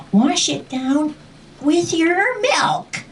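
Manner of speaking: high, drawn-out acted instruction; urgent coaching. Dragon telling child how to swallow turnip, high slow urging. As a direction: stretch each word out up high.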